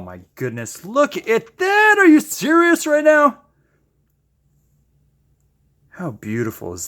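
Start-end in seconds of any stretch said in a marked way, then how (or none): 0.8-3.4 amazed. Real, not acted. Marvelling at the paint pour.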